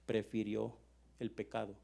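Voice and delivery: low, soft, with pauses; God's quiet hurt. Acted. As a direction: let the rejection sink in, quietly. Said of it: "Y dice Dios". (Dios dolido, en voz baja y grave)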